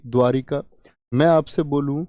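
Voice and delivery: usual speaking voice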